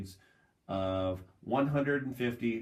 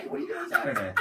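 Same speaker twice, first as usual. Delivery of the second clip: laughing question; faster than usual. Retold or imitated. Retold own question, laughing.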